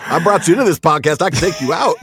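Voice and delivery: mock threat. Joking threat to co-host.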